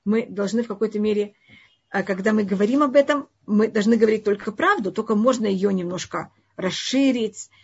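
ordinary talk, nothing marked

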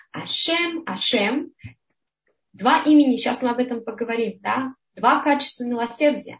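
usual speaking voice

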